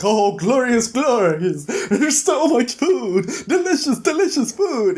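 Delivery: high, slow, giggly delight over food; gleeful pig voice. Retold or imitated. Straw-house pig finds the kitchen, giggly high delight. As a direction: swoon over the feast like a greedy pig.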